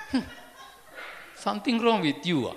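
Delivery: loud, hostile mock snap with a laugh; grumpy retort. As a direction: throw it back like an annoyed stranger. People glaring at a friendly greeter.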